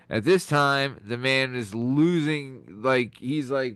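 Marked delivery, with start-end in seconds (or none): none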